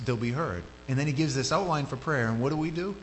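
ordinary talk, nothing marked

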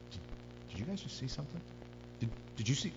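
acted hushed, halting question; hushed uncertainty. Rower asks his crewmates after a lightning flash, hushed. Rowers glimpse something in storm.

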